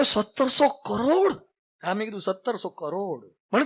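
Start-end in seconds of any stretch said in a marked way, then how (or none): 0.0-1.3 amazed question. Retold or imitated. Boy asking the swami about a huge salary, amazed. 2.2-3.3 low, soft, slow falling answer; matter-of-fact confirmation. Retold or imitated. Swami answering the astonished boy, quietly matter-of-fact.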